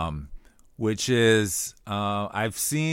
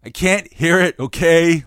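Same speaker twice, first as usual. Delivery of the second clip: frustrated outburst; 8 semitones higher, 8 dB louder, slower than usual. Retold or imitated. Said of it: "where it's like".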